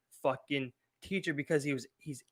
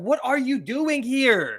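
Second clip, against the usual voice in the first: slow, higher, louder disbelieving voice; incredulous; 9 semitones higher, 7 dB louder, slower than usual. Retold or imitated. Student judging a bad teacher, slow incredulous scoff. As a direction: stretch it out like you can't believe it. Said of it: "you're like".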